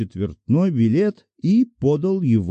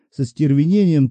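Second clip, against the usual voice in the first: ordinary delivery, nothing marked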